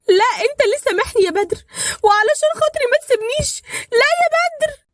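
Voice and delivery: very high, loud crying plea; desperate pleading. A narrator's reading. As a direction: beg him not to die, voice breaking. Wife begs dying husband. (توسل باكي بصوت عالي جدا)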